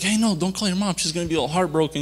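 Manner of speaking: imitated urgent warning. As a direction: act out a friend heading off a mistake. Friend urging him not to call.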